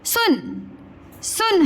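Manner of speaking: सुन with a short, quick u; correct short vowel. Shown as a demonstration. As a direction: keep the u in सुन brief. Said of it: "हम बोलते हैं".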